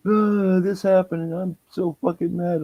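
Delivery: high-pitched imitation of her ranting; mock angry rant. Retelling how she burst into the bedroom mad, mock angry rant. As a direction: burst in venting, exaggerated and high. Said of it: "fucking come busting into the fucking bedroom".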